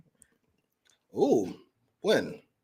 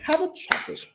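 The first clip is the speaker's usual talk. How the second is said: whispered mock coo; 3 dB quieter than usual. Echoing the chocolate line.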